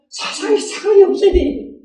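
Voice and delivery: high, ringing shout from the pulpit; preaching shout. Real, not acted. Preacher shouting the core teaching to his listeners. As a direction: cry it out to the hall with full force.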